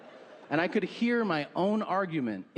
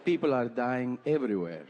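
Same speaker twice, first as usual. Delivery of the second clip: put-on Peruvian accent; 4 semitones lower than usual. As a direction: lay out the doom in a light Peruvian accent. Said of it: "she's from South America, Peru".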